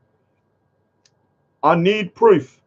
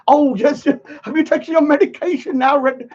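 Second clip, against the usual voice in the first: higher, sing-song mock of a fussy carer; a higher, sing-song nurse parody; mocking imitation; 6 semitones higher than usual. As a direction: taunt him like a fussy nurse. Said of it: "I'm not here to freaking".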